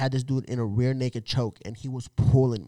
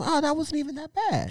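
mock tough nonchalance; 14 semitones higher than usual. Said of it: "I ain't no punk".

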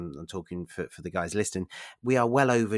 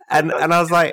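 higher and louder, building excitement; excited; 7 semitones higher, 10 dB louder than usual. Real, not acted. To Nkechi, recalling the mentor, excited. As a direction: tell it with rising glee.

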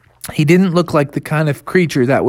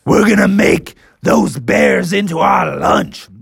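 slow, higher monster voice; menacing T-Rex; 6 semitones higher, slower than usual. Acted. T-Rexes threatening to eat the bears, menacing. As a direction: drag out the threat.

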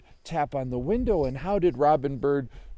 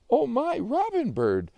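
high, drawn-out cry of amazement; astonished; 12 semitones higher, 5 dB louder than usual. Acted. Joey spots the bird on his windowsill, astonished. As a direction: exclaim slowly, well up in pitch.

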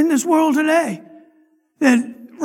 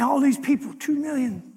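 ordinary talk, nothing marked

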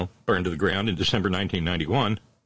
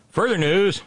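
excited announcer; 11 semitones higher, 5 dB louder, slower than usual. Acted. High loud announcer burst.

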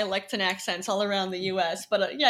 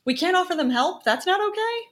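higher-pitched mock disbelief; mock incredulous; 7 semitones higher, 3 dB louder than usual. Host mocking objectors to helping the homeless, mock incredulous. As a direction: act appalled at the very idea.

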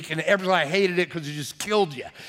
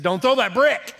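sharp warning; 6 semitones higher, 5 dB louder than usual. Retold or imitated. Mother warning son with brick.

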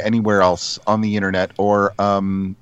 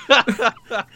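laughing; 13 semitones higher, 3 dB louder, much slower than usual. Real, not acted. Reacts laughing at joke.

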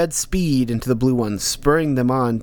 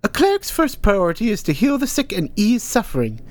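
put-on higher old woman's voice, firm and instructive; lecturing old teacher; 9 semitones higher than usual. Hilda Dee lecturing Ank on clerics, firm. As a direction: drill the lesson into him.

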